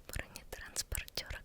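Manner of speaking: ordinary delivery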